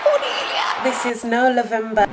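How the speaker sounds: high, showy put-on greeting voice; diva greeting. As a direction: sweep in like a star greeting her fans. Camp greeting to viewers on camera.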